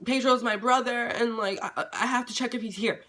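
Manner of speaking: nervous stutter, stumbling over each phrase; shaking stammer. Retold or imitated. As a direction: act out blurting a lie while shaking with fear. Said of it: "I'm shaking".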